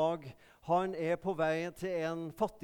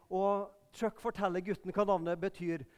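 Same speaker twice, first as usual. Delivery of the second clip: normal talking voice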